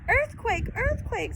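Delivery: high, loud alarmed cry; a bystander shouting a warning; shouted. Acted. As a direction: yell it out in panic. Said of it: "someone shouted".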